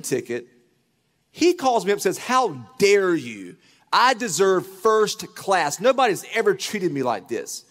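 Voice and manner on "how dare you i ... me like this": indignant, louder, higher complaining; mock outraged rant. Consultant ranting at the pastor about his plane ticket, indignant and loud. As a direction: play the offended diva demanding first class. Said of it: "he was just trashing us".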